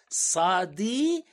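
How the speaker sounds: סעדי said slowly with a throaty pharyngeal ayin; drawn-out guttural ayin in סעדי; Eastern ayin. Shown as a demonstration. Lecturer demonstrating Mizrahi ayin, slow throaty. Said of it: "פ', פ'".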